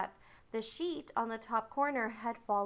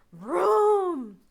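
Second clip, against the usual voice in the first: a loud, high imitated thunder rumble; loud vocal thunder effect; thunder vroom; 7 semitones higher, 10 dB louder than usual. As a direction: boom it out like thunder rolling in.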